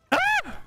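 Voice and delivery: short, very high-pitched startled scream. Real, not acted. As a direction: yelp sharply in sudden alarm. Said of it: "don't listen to my screams".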